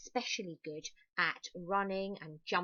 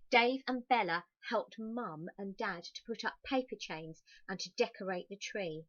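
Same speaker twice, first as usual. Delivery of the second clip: ordinary delivery, nothing marked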